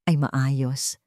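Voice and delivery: ordinary delivery, nothing marked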